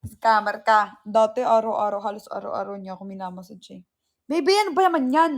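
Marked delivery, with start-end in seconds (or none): 4.2-5.3 high, fast whining protest; whiny complaint. Real, not acted. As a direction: whine at him, exasperated. (nagrereklamong parang bata)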